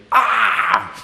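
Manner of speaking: high, crying demonic "ah"; demonic wail. Shown as a demonstration. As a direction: wail it like one possessed. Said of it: "de un endemoniado es diferente".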